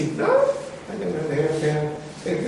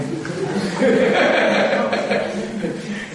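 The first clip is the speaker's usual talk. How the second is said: long laugh; 3 semitones higher than usual. Real, not acted. Preacher laughing at own story.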